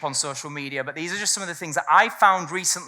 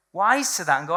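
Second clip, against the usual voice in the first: normal talking voice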